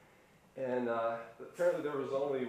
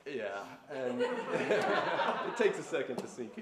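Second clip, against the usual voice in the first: laughing, slowed and louder; 3 semitones higher, 6 dB louder, much slower than usual. Real, not acted. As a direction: chuckle through the words. Laughing after the joke.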